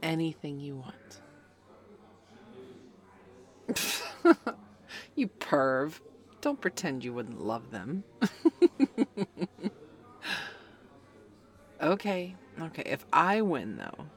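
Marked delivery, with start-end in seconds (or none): 3.7-4.5 sudden high airy laugh of delight; teasing laugh. Acted. Teasing her friend about panties, bursting into loud laughter. 8.1-9.8 loud laugh that falls away; mocking laugh. Mocking her friend's flustered reaction with loud laughter.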